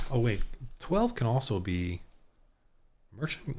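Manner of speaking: ordinary talk, nothing marked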